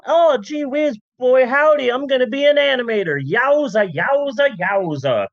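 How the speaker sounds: a hammed-up eager thirties girl squealing with glee; 1930s gal. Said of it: "'cause it's the thirties".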